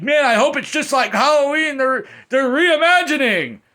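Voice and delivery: high, loud mocking fan voice. Mocking hopeful fans of the reboot, high and loud. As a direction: parody a naive fan. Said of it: "a lot of people are like".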